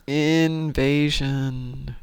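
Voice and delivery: Invasion dragged out very slowly; slow drawn-out word. Pretend. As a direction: stretch out Invasion syllable by syllable.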